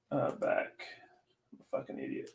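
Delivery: a breathless whisper at himself; real whispered mutter; whispered self-scolding. Real, not acted. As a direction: scold yourself under your breath. Muttering at his own mistake.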